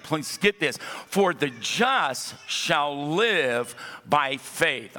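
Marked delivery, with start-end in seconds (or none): 1.1-5.0 each word laid down slowly, ending on faith; slow emphatic quote. Shown as a demonstration. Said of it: "I want to say that again".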